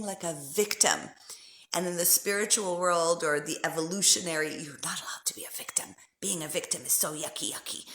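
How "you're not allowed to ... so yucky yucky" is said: hushed whisper; conspiratorial whisper; mock whispered rule. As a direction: whisper it like a scolding spiritual taboo. Mocking spiritual rule.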